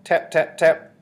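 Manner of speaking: high, loud, percussive imitation of knocks; spoken knocking effect; loud tapping. A narrator's reading. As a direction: sound out each knock sharply. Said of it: "The bottle made quite a loud tap".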